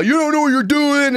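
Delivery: high-pitched imitation of an angry passenger; mock angry yell. Angry passenger yelling at the gate staff, high mock yell. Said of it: "people who would yell".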